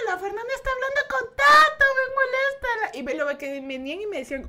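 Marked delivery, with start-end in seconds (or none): 0.1-3.0 teasing family. Retold or imitated. Family telling on her.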